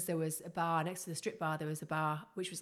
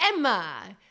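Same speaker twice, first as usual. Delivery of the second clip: imitating a Venezuelan accent, higher and much louder, slow; Patricia's Venezuelan accent; 4 semitones higher, 11 dB louder, much slower than usual. Retelling her Venezuelan friend's marriage advice in her accent. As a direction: put on the boss's loud Venezuelan accent. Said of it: "That's how she used to speak".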